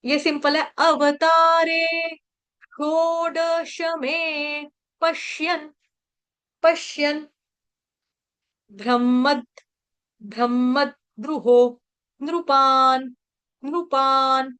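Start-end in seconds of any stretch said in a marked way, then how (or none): 0.8-5.7 very slow, drawn-out chanting syllables; broken up for repeating. Shown as a demonstration. Teacher breaking a verse up for devotees to repeat, slow chanting. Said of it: "तोड़ तोड़ के हम पढ़ेंगे". 6.6-14.5 slow word-by-word recitation with long vowels; broken up for repeating. Shown as a demonstration. Teacher feeding the verse word by word to learners, slow recitation. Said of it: "तोड़ तोड़ के हम पढ़ेंगे".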